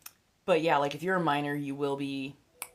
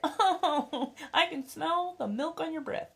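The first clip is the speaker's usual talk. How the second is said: much higher, laughing, I can smell the milk on your breath; mock disgusted voice; 10 semitones higher than usual. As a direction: recoil in high squeaky disgust. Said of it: "it's just like".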